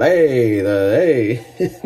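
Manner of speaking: loud, high Italian-style "Hey!"; inner Italian. Pretend. Tasting sweet leather, he shouts hey like an Italian. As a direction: let your inner Italian burst out with a hearty hey. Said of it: "my inner Italian came out".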